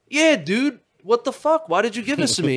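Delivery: high, loud retold protest; mock outrage. High, confronting the friend.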